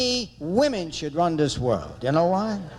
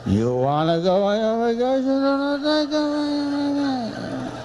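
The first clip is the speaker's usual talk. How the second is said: smeared whine climbing higher and higher; mumbling husband; 7 semitones higher than usual. Retold or imitated. Husband repeating his vacation offer, mumbling. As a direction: slur the offer into one long drone. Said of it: "He sounds like this".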